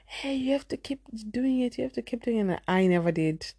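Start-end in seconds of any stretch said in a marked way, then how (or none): 0.0-2.4 mom's insistence, pitched higher and softer; a light take on a nagging mother; imitating mom. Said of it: "And my mom was like".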